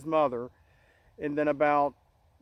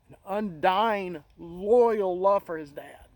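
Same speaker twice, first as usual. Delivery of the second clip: slow, emotion welling up; moved, words slowing as feeling rises; choked up; slower than usual. Real, not acted. Speaking of grandfather's love for his dad, choked up. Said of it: "I'm getting a little per-, uh, emotional".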